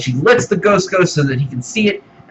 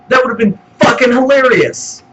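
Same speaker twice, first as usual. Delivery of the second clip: excited outburst; 10 semitones higher, 4 dB louder than usual. Real, not acted. Ranting about a wasted scene.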